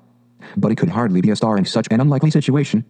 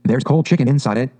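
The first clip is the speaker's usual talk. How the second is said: usual speaking voice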